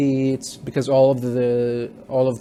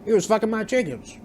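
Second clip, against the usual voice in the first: much higher, put-on voice of the angry farmer; odd villager voice; 9 semitones higher than usual. Farmer explaining the fight, high put-on voice. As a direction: squeak it out as the wronged chicken owner. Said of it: "And he's like".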